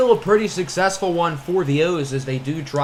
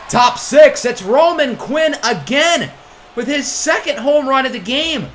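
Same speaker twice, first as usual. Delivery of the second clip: excited home run call; 8 semitones higher, 5 dB louder than usual. Real, not acted. Calling another home run.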